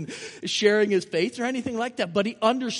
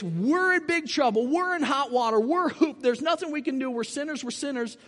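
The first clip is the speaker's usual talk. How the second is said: mock lament; 7 semitones higher than usual. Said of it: "we've been hammered with".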